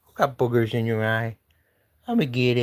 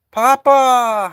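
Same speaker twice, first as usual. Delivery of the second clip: high, loud shout of the name; shouting a name outside; louder call; 11 semitones higher, 7 dB louder than usual. Real, not acted. Calling cat louder.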